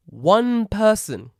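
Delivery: "one person" stretched slow, high and loud; "one person", drawn out big and loud; stress on one person. Acted. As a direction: drag out "one person" for outrage. Emphatic count of workers.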